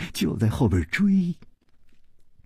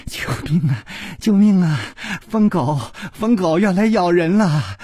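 breathless calling; 7 semitones higher than usual. A narrator's reading. Shi Xiuyun flees the mad dog, breathless mock cries. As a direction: call out in mock alarm, out of breath. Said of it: "不停地喘息， 轻轻地喊着".

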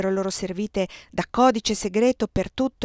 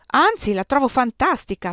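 entusiasta; 6 semitones higher, 5 dB louder than usual. Acted. Maurice esalta con entusiasmo.

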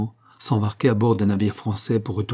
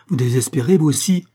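agitated, higher-pitched reproach; crying out; 5 semitones higher, 4 dB louder than usual. A narrator's reading. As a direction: protest in a raised, urgent voice. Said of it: "s'écria Lucien".

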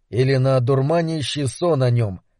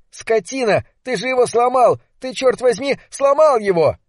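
furious shriek; 8 semitones higher than usual. Acted. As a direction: shriek at him for breaking the radio. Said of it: "визжа от ярости". (визг в ярости)

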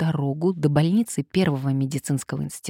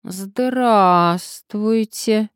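very slow, higher, louder drawl; drawn-out greeting; 5 semitones higher, 5 dB louder, much slower than usual. A narrator's reading. Patient to an unexpected visitor, drawling. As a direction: stretch the greeting out while sizing her up. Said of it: "протянул Сарычев".

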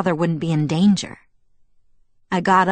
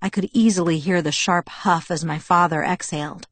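plain reading voice

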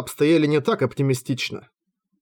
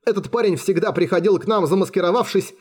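high-pitched, nervous gabble; frightened captive's babbling; jabbering; 7 semitones higher than usual. A narrator's reading. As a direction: spill it all anxiously, voice up. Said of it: "затараторил пленник".

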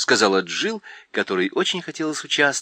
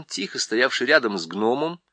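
plain reading voice

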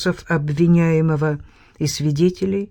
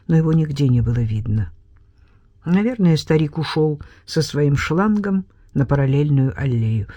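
ordinary delivery

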